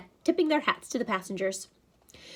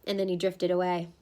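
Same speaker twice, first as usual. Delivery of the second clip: usual speaking voice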